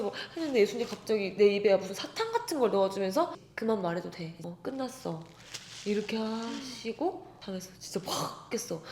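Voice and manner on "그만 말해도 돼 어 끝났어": soothing. Retold or imitated. Jesus soothing her in dream.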